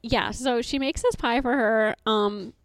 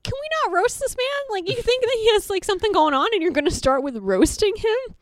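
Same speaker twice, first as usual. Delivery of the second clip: much higher and louder, incredulous; exasperated high pitch; 12 semitones higher, 8 dB louder than usual. Retold or imitated. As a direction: protest in disbelief, pitched way up. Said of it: "I was like".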